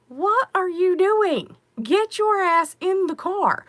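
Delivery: high, loud, slow scolding; wife yelling at hothead husband, loud and slow; exasperated yell. Retold or imitated. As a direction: yell at him to get back in. Scolding husband in retold scene.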